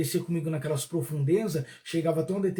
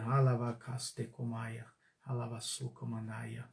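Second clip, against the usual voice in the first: low, slow, much softer tongues; speaking in tongues; 6 semitones lower, 10 dB quieter, slower than usual. Acted. Preacher on a live stream praying in tongues, low and fading.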